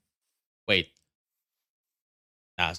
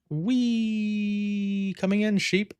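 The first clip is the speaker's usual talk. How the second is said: playful squeal; 11 semitones higher, much slower than usual. Pretend. Diving at sheep.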